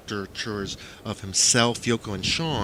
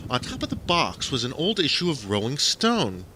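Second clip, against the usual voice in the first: usual speaking voice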